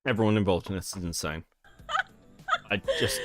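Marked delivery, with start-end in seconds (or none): 2.7-3.2 laughing. Real, not acted. Laughing through words.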